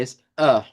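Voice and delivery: "A" with the tongue pulled back to the centre; Portuguese centralized A sound. Shown as a demonstration. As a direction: say "A" from the middle of the mouth, Portuguese style. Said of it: "La lengua va acá al centro".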